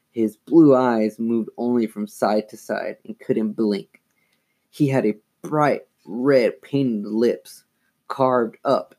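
plain reading voice

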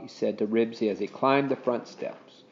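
plain reading voice

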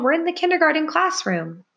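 high-pitched call across rooms; high summoning voice. Acted. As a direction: call out where you are, high and carrying. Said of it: "she called".

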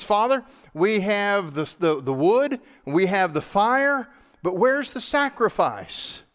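a hint of an anxious teenage boy; young teenage son. Isaac asking his father, lightly voiced young boy. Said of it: "the voice of this probably young teenage son".

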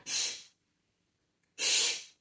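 shh sussurrado e espaçado; pedindo silêncio. Acted. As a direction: mande calar com um chiado. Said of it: "faz um barulho e você fala". (psiu de silêncio)

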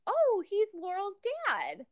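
high, slow dawning realization; imitated naive reaction. Retelling her reaction to the show, imitated naive realization. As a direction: mimic her high-pitched, drawn-out discovery.

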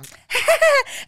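real high laugh that breaks out loud; high burst of laughter. Real, not acted. Joking with her co-host about sitting ducks, loud high laugh. Laughing at their earthquake risk.

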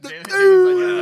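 calling out his friend Jalen loudly; teasing shout. Real, not acted. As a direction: shout it slowly to tease him. Teasing friend about the actress.

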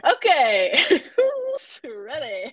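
loud, drawn-out "who's ready" hype; rally the crowd with a laugh; big playful high-energy call; hyped-up rallying call. Real, not acted. Hyping up the next episode.

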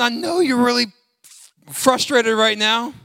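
lightly voiced, laughing mock sympathy; mock God voice. Pastor mocking a God who praises grudging givers, laughing. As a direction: tease it like a sarcastic God. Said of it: "God is not looking at you going".

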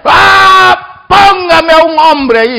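high, drawn-out challenger's yell; Goliath shouting. Acted. Goliath demanding a man to fight, shouting. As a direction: bellow the challenge across a battlefield. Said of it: "Ahí estaba Goliat gritándole".

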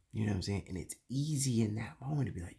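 ordinary talk, nothing marked

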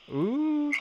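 a teasing falsetto coo; high falsetto ooh. Acted. Playful high coo before nasty vibes.

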